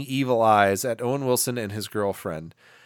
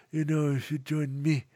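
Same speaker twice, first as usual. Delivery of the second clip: put-on softer, halting, terrible foreign accent; Jon Voight; 5 dB quieter than usual. Villain luring a man into catching the snake, terrible accent. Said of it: "with his terrible accent".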